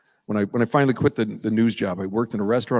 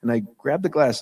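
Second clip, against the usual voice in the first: ordinary talk, nothing marked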